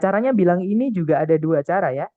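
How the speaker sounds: normal talking voice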